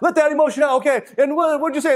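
high lightly voiced coach; coach's voice. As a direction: play the coach urging him on. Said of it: "you just have me stare at this point".

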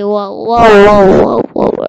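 very loud yelling whoas; loud shout. Real, not acted. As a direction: scream it in shock. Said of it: "I'm sorry if I'm so loud".

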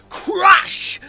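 loud, high comic-book crash; shouted sound effect. A narrator's reading. Narrator voices Hitchhiker being hurled, shouted high and loud.

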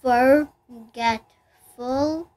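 Forgetful said slowly as for-get-ful; teacher counting for-get-ful; slow syllable split. Shown as a demonstration. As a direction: pause between for, get and ful. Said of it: "That's also one syllable".

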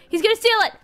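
high panicked yell; alarmed shout. Real, not acted. Repeats in alarm.

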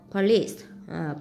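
ordinary talk, nothing marked

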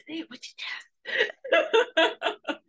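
shrieking high, laughing command; say it with your chest. Shown as a demonstration. Coach urging the audience, nearly squealing with laughter. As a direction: cry it out with a laugh.

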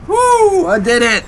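very high, loud victory whoop; triumphant cheer. Real, not acted. Player cheering at the screen after clearing the hard part, triumphant whoop. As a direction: shout it like you finally won.

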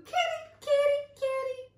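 piercing high bird calls; toucan calls. A narrator's reading. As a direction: screech out loud tropical bird cries. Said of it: "Toucans pierced the curtain".

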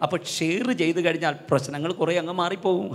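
usual speaking voice

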